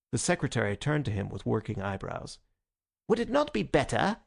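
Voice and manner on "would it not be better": high-pitched, sharp query; a little sharp. A narrator's reading. Secretary questions the President's haste, a little sharply. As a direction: object with a sharp edge. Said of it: "he said a little sharply".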